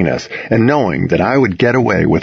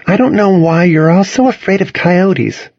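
high protesting Cassidy; 9 semitones higher than usual. A narrator's reading.

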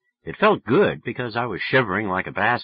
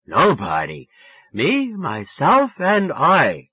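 put-on sloppy, sing-out drunken answer; 5 semitones higher, 5 dB louder, slower than usual. A narrator's reading. Drunk boy telling Sally who's with him, drawling. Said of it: "Boy, was I drunk".

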